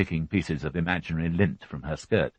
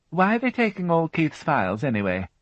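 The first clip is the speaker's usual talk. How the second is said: lightly raised fussy housewife voice; a higher, brisk widow answering the policeman; Mary Rothwell voiced; 12 semitones higher, 3 dB louder than usual. A narrator's reading. Widow answering the inspector, a higher fussy voice.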